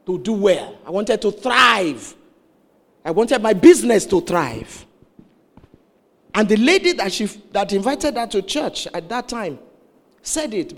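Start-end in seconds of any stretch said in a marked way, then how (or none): 0.1-4.9 building to a loud, stretched thrive; retold emphatic confession. Woman confessing about her business, loud emphatic retelling. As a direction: voice her wish with rising force.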